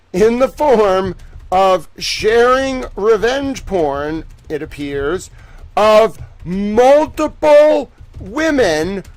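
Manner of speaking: slow, loud, high-pitched announcement; dramatic news read. Acted. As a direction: read each phrase like breaking news, slowly. Dramatic slow reading of filing.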